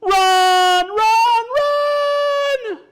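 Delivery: high, loud yells climbing each time; hammed-up storybook alarm; panicked shouting. Animals scream and flee.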